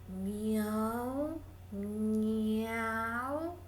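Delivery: soft drawn-out cat meow, rising; sweet meow. Acted. The cat answers the mouse with a sweet meow. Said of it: "dijo el gato con un maullido muy dulce".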